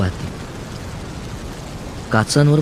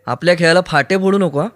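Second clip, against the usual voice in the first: mild yell; 7 semitones higher than usual. A narrator's reading. Aruni objects to her spoiling their game, mild yell. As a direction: protest in a higher voice. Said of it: "Aruni ओरडला".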